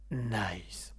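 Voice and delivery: breathy whispered signature nice. Shown as a demonstration. Radio host whispering his trademark word for a listener. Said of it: "pour Mouton, je vais le refaire".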